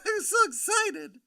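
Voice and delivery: high, squealy pretend excitement; mock excited. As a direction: gush it in a hammed-up high voice.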